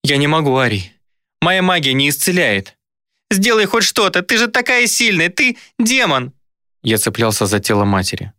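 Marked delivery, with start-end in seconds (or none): 0.0-2.8 higher, distressed woman's voice; pained refusal; helpless apology. A narrator's reading. Demon helplessly refuses dying woman's child. 3.3-6.3 very high, frantic begging; high-pitched desperate demand; desperate child's plea. A narrator's reading. Child begs demon to save mother.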